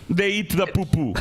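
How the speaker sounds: laughing fake African accent; mock African accent. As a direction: shout it in a crude accent. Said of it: "Is that your African accent?".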